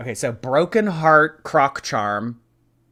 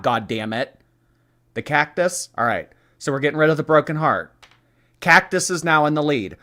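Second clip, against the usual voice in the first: ordinary talk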